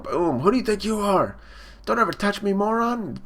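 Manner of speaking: high-pitched mock outrage; imitated indignant brother; bullying threat. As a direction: act out a bully hitting back. Brother punches back, threatening.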